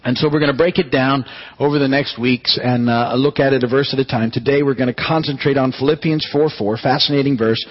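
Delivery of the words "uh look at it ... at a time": ordinary talk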